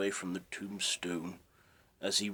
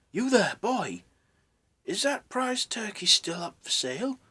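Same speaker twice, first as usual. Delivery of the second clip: calling out loud and much higher; 13 semitones higher, 7 dB louder than usual. Acted. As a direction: hail the boy down in the street. Scrooge calls out the window.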